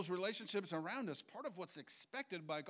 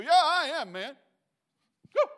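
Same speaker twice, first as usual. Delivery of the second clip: much higher and louder mock swagger; cocky boast; 12 semitones higher, 15 dB louder than usual. Preacher voices a proud man accepting praise, loud mock swagger. Prideful response acted out.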